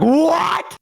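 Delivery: high, sudden shout of disbelief; shocked shout. Retold or imitated. Kid stunned that his mom agreed, high shout. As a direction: yelp it out, betrayed. Reacting to mom allowing paddling.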